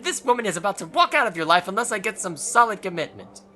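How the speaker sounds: hammed-up fury at a partner; mock angry tirade.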